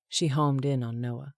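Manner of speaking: ordinary delivery, nothing marked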